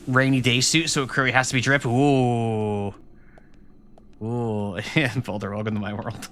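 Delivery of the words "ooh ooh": usual speaking voice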